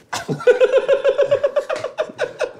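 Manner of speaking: long high-pitched burst of real laughter. Real, not acted. Guest cracking up at her own surgery story, high laughter. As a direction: let the laugh take over, high and long. Reacts to [name] line.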